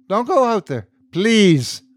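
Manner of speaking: high-pitched, desperate plea; crying out. A narrator's reading. Priest begs man not to leave booth, desperate cry. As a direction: plead urgently, pitch way up. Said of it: "I cried".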